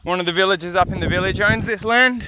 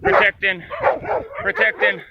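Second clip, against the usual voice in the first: much higher, cooing at the dogs; high pet voice. Real, not acted. Greeting the protective dogs, high cooing pet voice. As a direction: coo it up high like talking to a puppy. Greeting his dog's family.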